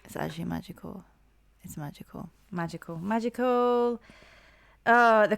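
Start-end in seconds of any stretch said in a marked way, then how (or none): 0.0-2.3 awed hush. Real, not acted. Awestruck, fading to near hush. 2.5-3.0 "Magical." said much softer, barely above a breath; hushed. Real, not acted. Fan overcome by the song, saying "Magical." in a hush. Repeats the word, awed. 3.0-4.0 "Magical." stretched out slowly at normal volume; drawn out. Real, not acted. Fan relishing the song, drawing "Magical." out slowly.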